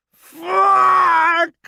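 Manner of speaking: full-throated raging scream; furious scream. A narrator's reading. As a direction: scream it out in fury. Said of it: "I scream".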